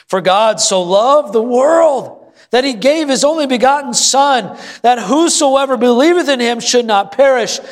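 high, fervent verse proclamation; impassioned scripture preaching. Real, not acted. Pastor proclaiming God's love to the congregation, impassioned scripture preaching. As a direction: declaim the verse high and emphatic.

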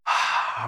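long relaxed sigh out; deep breath. Shown as a demonstration. Pastor leading the congregation in a deep breath, relaxed sigh. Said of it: "Let's all take a deep breath".

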